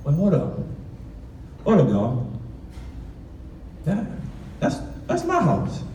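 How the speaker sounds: put-on stunned kid spotting his own home; dawning shock. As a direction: realize with horror it's your own house. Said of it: "and I'm like".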